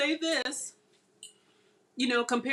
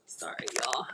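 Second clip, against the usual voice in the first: whispering; 10 semitones lower, 8 dB quieter than usual. Real, not acted. Whispered aside during filming.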